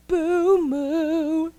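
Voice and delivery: a forlorn cow's moo; sad moo. Acted. Thirsty cow walking away from the well, sad moo.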